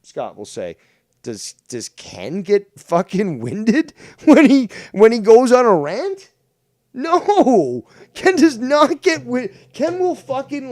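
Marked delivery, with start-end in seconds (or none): 1.2-10.7 high, loud and incredulous; indignant rant. Real, not acted. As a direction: rant in a raised, emphatic pitch. Indignant rant defending Ken.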